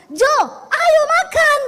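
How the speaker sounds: high, loud, slow call across the house; loud Sulawesi-style call to breakfast; shouting to husband. Retold or imitated. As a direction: yell for him to come and eat. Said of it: "saya teriak sama suami saya".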